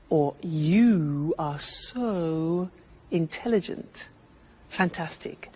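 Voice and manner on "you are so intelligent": "so" stretched very slowly in "You are so intelligent"; drawn-out so. Shown as a demonstration. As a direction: stretch the "so" long and fade. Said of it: "when you want to emphasize something".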